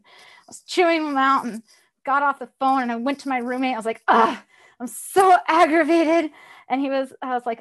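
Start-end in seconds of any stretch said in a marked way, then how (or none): 4.1-6.3 loud, high frustrated groan; exasperated venting. Retold or imitated. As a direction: vent your frustration, exasperated. Retelling venting to roommate.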